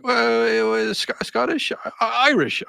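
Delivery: hesitant high put-on voice; guessing salesman. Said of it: "And he's like".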